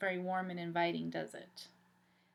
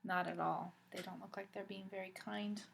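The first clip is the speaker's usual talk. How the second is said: ordinary delivery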